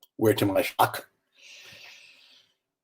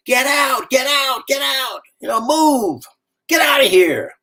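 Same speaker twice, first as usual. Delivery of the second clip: higher, shouted commands over rotor noise; yelled evacuation order; 11 semitones higher than usual. Retold or imitated. Rescuers shouting from a helicopter overhead, urgent yell. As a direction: bark it like a rescuer hanging out of a helicopter.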